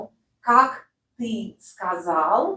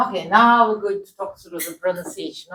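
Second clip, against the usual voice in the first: ordinary delivery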